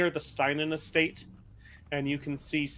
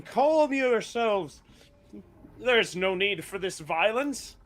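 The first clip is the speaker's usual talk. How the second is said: raised, higher voice pleading for order; Morthias; 6 semitones higher, 3 dB louder than usual. Acted. Morthias addressing an angry mob, raised imploring voice. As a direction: call out to calm a crowd, pitched up. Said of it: "in front of three different, or th-, a loud, angry mob, saying".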